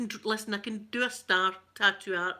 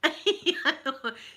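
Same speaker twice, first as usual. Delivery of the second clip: laugh; 8 semitones higher, 4 dB louder than usual. Real, not acted. Laughs before monk's wine joke.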